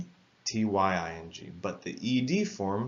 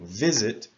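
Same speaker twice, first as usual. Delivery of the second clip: "Visit" with VIS punched louder, sit falling away; stress on the first syllable; 3 semitones higher, 6 dB louder than usual. Shown as a demonstration. Teacher showing learners where "Visit" is stressed, first syllable hit. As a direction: lean hard on VIS in "Visit". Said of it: "the stress is at the beginning".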